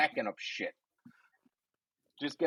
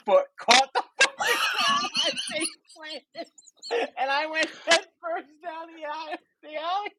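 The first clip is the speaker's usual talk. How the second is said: laughing. Real, not acted. Laughing retelling of fall.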